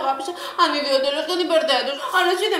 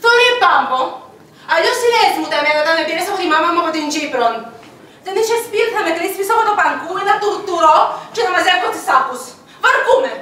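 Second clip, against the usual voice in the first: put-on complaining in a thick Cypriot accent. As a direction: lay the Cypriot vowels on heavily. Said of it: "Έχεις προφορά". (παραπονιάρικη κυπριακή προφορά)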